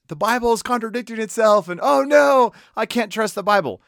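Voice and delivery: high, loud mock outcry of alarm; mock yelling. Congregation yelling at the preacher about contradictions, mock yelling. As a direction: wail it in exaggerated panic. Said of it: "yelling at me later about".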